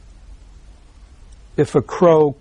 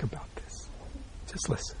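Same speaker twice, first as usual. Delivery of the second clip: soft whisper, urging; hushed instruction; 3 semitones lower, 20 dB quieter than usual. Real, not acted. Teacher whispers to his listeners to stop thinking. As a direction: whisper it so they lean in.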